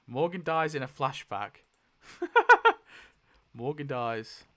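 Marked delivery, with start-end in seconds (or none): 2.1-3.0 a short, loud, high burst of laughter. Real, not acted. Laughing at the roll result, loud high laugh. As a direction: cackle out loud. Laughing at Morgan's flashback death.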